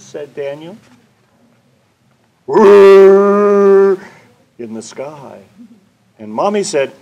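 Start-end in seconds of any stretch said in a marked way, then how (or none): 2.4-4.0 much louder droning whirr; loud long airplane whirr. Acted. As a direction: drone like a passing plane. Airplane sound in story.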